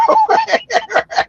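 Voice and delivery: a high squealing laugh; wheezing high laugh. Real, not acted. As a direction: cackle at the punchline. Laugh at funeral line.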